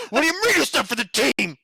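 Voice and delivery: high, loud mock outrage; Dave impression. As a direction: imitate Dave's indignant yell. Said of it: "That's my Dave impression".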